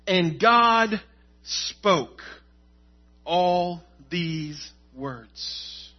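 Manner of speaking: very slow, word-by-word verse reading; deliberate, solemn reading with long pauses; emphatic scripture reading. Acted. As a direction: weigh each word, pausing between phrases. Re-reads verse with weight.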